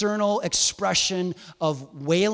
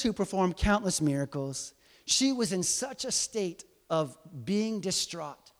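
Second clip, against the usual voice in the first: ordinary talk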